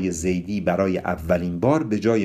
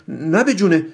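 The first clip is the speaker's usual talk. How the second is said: high, louder scared denial; nervous, raised-voice excuse; frightened; 10 semitones higher, 6 dB louder than usual. A narrator's reading. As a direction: deny it fearfully, pitch jumping up. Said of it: "با ترس گفت".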